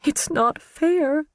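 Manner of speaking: high, slow, whimpering cry; pitiful breaking plea. Acted. Karen breaking down on the witness stand, whimpering. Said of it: "she whimpered".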